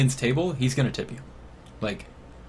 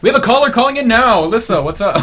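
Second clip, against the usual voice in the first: radio DJ voice; 7 semitones higher, 6 dB louder than usual. Pretend. Continuing radio DJ bit.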